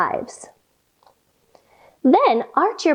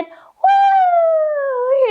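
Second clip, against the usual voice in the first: very high, excited squeal; high delighted mouse whoop. Acted. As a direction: squeal with glee. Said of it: "The mouse said".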